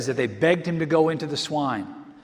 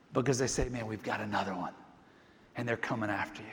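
hushed aside; 7 semitones lower, 11 dB quieter than usual. Acted. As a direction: drop much softer and lower, almost confiding. Quiet aside about demons.

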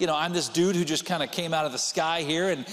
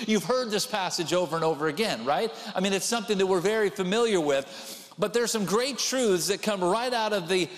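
usual speaking voice

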